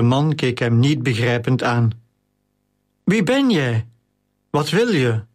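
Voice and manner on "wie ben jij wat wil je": higher-pitched, pressing questions; insistent questioning. A narrator's reading. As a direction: press for an answer, pitch jumping up. Questioner repeats himself to dazed slave. (aandringende, hogere vraag)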